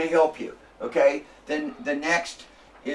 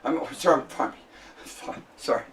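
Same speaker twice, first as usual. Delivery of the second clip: normal talking voice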